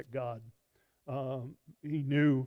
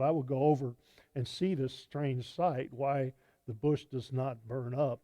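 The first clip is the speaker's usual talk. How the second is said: raised, projected reading of the passage; scripture reading; 6 dB louder than usual. Acted. Preacher reading Exodus aloud to the congregation, projected and louder. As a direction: proclaim the verses to the congregation.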